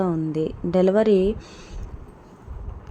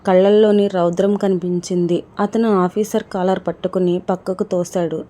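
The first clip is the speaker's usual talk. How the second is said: usual speaking voice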